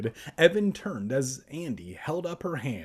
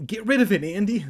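high, loud plea to be rid of it; acted alarmed command; urgent order; 8 semitones higher, 7 dB louder, much faster than usual. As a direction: push it out urgently, pitched up. Acted urgent order, redone louder.

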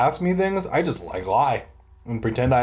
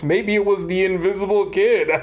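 a hint of a smug little brother; smirking; 7 semitones higher, 3 dB louder than usual. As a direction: tease with a smirk, pitched up. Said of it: "Simon smirked".

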